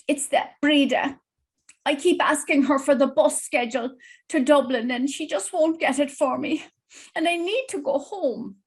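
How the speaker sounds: a lightly voiced anxious mom begging to go home; worried old mother. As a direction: plead like a distressed elderly mother wanting home.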